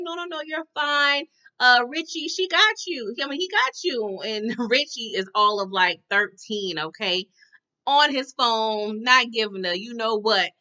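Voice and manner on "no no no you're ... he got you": higher, bright no no no; lightly voiced daughter soothing him; mock reassuring. Daughter telling the husband the valet has him, mock reassuring. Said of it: "she was like".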